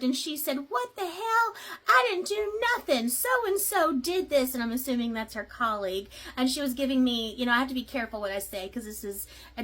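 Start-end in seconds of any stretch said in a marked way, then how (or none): 0.7-4.5 high, indignant mock outburst; outraged protest. Served woman protesting to the process server, high and outraged. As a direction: squeal it out like a wronged woman.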